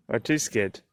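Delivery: ordinary delivery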